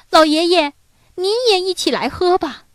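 put-on high-pitched, eager child's voice; little child. A narrator's reading. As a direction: invite grandpa sweetly as a small child. Children invite grandpa to eat. (孩子高声热情地邀请)

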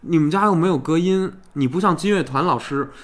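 ordinary talk

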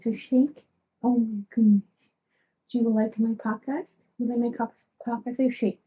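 high, cooing talk to a pet. Real, not acted. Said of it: "I switch to my baby talk voice".

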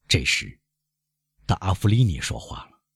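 ordinary delivery, nothing marked

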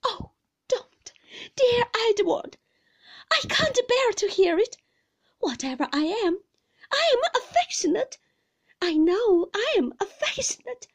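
high, loud, desperate insistence; piteous imploring. A narrator's reading. Mother insisting to her husband she is affectionate, piteous and high. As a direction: plead pitifully at the top of the voice range. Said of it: "very piteously".

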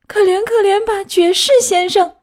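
put-on high, loud begging puppet boy; pleading. A narrator's reading. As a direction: beg for mercy in a high-pitched wail. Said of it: "开始哀求他说". (尖着嗓子哀求)

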